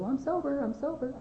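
high-pitched, softening mock protest; insistent protest. Jailed daughter to family on the phone, high insistent protest. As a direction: plead your case in a small high voice. Retelling her call home from jail.

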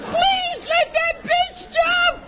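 very high, slow, wailing mock shout; husband's mocking wish. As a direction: stretch it out in a shrill crying mock. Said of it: "He says".

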